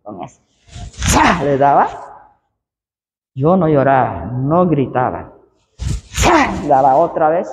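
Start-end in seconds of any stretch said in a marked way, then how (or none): 0.7-1.4 high, sharp hissed "sa" of a blow; sudden swish-smack noise; imitated whack. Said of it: "suena así". 5.8-6.5 high, sharp hissed "sa" of a blow; sudden swish-smack noise; imitated whack. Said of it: "suena así".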